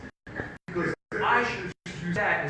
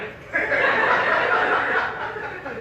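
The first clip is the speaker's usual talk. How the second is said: baffled laugh; 11 semitones higher, 7 dB louder than usual. Real, not acted.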